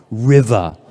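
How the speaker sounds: normal talking voice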